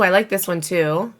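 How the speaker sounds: normal talking voice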